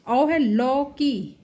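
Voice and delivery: लौकी said very slowly, औ held long; teacher stretching लौकी; drawn-out demo. Shown as a demonstration. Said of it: "देखिए लौ".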